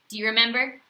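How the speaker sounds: plain reading voice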